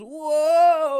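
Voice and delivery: long, loud, high "whoa"; mimicked cry of someone losing their hold; imitated falling yell. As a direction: act out toppling backwards with a big yell. Acts out partner falling backwards.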